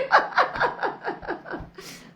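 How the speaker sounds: high, loud burst of laughter. Real, not acted. Wife laughing at her husband's reply, high laugh. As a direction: laugh out at his answer.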